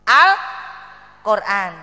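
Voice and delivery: loud, very stretched "Al-Quran"; loud first syllable, long hold; drawn-out audience prompt. Real, not acted. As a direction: draw the word out for the audience to join.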